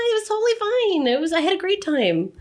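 high-pitched, hammed-up insistence that all was well; mock reassurance. Mother playing her future self dismissing old complaints, mock reassurance. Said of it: "maybe next year you'll be going".

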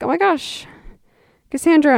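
high, gushing "oh my gosh"; high-pitched mock-excited hello; excited greeting. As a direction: gush his surprise at meeting her. Date greets her excitedly.

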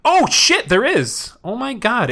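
high, startled burst; excited discovery. Pretend. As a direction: exclaim it high, amazed at the find. Discovers something else in the tube.

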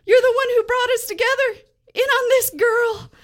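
hammed-up sobbing gratitude; gushing. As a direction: gush with overblown gratitude. Gushing thanks to Calendar.

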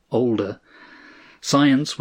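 ordinary delivery

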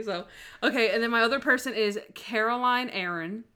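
normal talking voice

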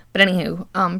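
ordinary talk

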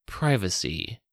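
ordinary delivery

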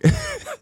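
a sudden high laugh; short, high real laugh; high burst of laughter. Real, not acted. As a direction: crack up at the memory. Laughing at the memory.

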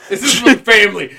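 loud, quick, through laughter; laughing echo. Retold or imitated. Host echoes co-host's movie impression, laughing loudly. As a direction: crack up while repeating the movie line.

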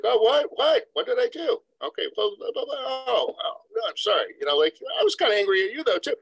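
surprised partner fumbling an apology in a high voice; flustered partner. Retold or imitated. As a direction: play the partner caught off guard, pitch way up. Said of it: "the other partner's like".

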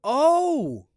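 high, loud sudden recognition; light up as it clicks; excited surprise. Real, not acted. Recognizing the movie title.

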